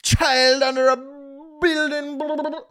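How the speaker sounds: loud, high, whiny mock-outrage; hammed-up offended listener; mock outraged critic. Mocking critics from the Middle East, loud whiny outrage. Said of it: "you're just like".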